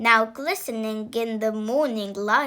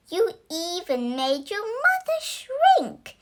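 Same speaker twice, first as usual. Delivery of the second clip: scolding mother; 6 semitones higher than usual. Acted. Mother scolds smelly son.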